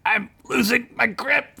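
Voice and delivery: high, straining voice; strained alarm. A narrator's reading. Fred holding Duncan's sleeve, strained high alarm. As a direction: strain as if hanging on hard. Friend slipping from Fred's grasp.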